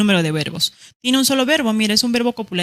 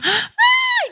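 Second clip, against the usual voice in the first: a sudden high shouted ay of pain; loud squeal of ouch; pained yelp; 5 dB louder than usual. Retold or imitated. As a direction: yelp as if pinched.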